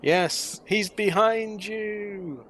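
slow, drawn-out panto call; panto crowd callback. Pretend. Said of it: "welcome to the Panto".

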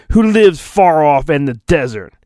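ordinary talk, nothing marked

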